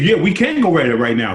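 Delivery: ready to throw down; tough challenge. Retold or imitated. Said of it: "And he said".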